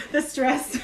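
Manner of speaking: laughing through it; giggling out the words. Real, not acted. Laughing while joking.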